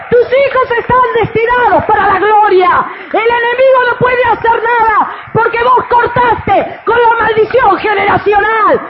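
loud, high, fervent preacher shouting; full-force preaching. Acted. Pastor preaching to the women, shouting with fervor. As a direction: preach it out to the whole church.

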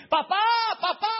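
grito muy agudo y fuerte; llama desgarrado a papá; grito de niño. Acted. Isaac llama a su padre. (Isaac en el altar llama a su padre, gritando)

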